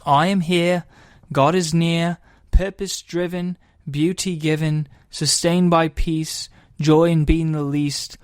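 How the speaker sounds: a mild put-on British accent for reading a poem. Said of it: "I'm gonna do this in an accent".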